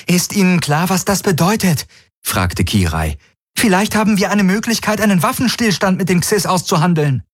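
hoch, FÜNF schrill betont; bestimmt, mit verdeckter Furcht; bestimmt, selbstsicher. A narrator's reading. Kirai hoffnungsvoll. (Kirai, hoch und bestimmt, Angst überspielend)

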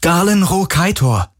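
high, urgent call. A narrator's reading. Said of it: "rief er laut".

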